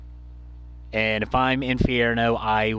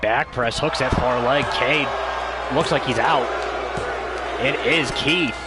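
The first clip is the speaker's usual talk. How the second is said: wrestling commentator; 3 semitones higher than usual. Real, not acted. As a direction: call the action as it happens. Live call of the finish.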